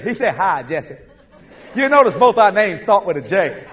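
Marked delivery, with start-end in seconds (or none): 0.4-1.0 low, soft casual reply; understated, easy hello back; Jesus replying. Acted. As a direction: answer quietly, much lower. Jesus answers him, low and soft.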